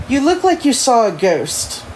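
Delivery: put-on British-accented character line. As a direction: voice her with a light British accent. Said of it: "give her a British accent".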